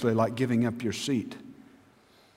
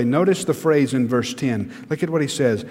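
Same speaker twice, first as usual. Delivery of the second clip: ordinary talk, nothing marked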